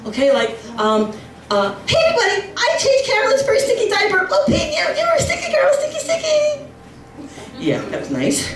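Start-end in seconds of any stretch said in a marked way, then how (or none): 1.9-6.6 squeaky high-pitched relative gushing; high mock toast. Comic mimicking a relative's toast at the wedding, shrill squeaky voice. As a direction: gush it like a doting uncle, very high.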